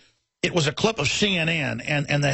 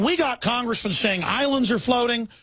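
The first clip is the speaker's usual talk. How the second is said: ordinary talk, nothing marked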